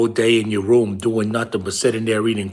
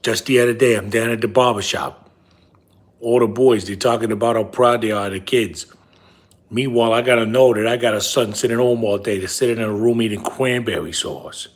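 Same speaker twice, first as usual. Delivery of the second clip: a lightly voiced lecturing father, louder; big-nosed dad voice; 4 dB louder, faster than usual. Father nagging his son about cranberry sauce, lightly voiced and louder. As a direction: scold the kid as his father, half joking. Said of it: "I got a big nose and I talk like this".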